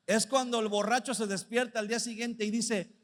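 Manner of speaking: ordinary delivery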